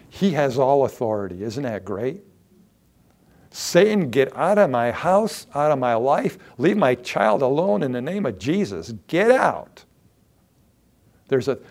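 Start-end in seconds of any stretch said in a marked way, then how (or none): 3.5-9.9 rebuking Satan. Acted. Believer ordering Satan out of the house, loud rebuke. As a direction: cast him out with force.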